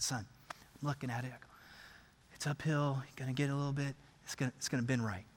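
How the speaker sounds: low, very soft murmur; hushed caddy dad. Acted. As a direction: advise your son under your breath. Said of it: "everyone's quiet".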